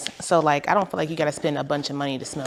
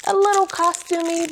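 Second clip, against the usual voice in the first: much higher squeaky costumey; playful pitch jump; 13 semitones higher, 4 dB louder than usual. Real, not acted. Vlogger teasing her costumey bracelet, high pitched.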